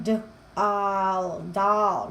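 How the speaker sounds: low, drawn-out sounds blended into "doll"; sounding it out. Shown as a demonstration. Mom showing how her kiddo sounds out short-vowel "doll", segmented. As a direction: say d, then the vowel, then the whole word "doll". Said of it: "we sound it out".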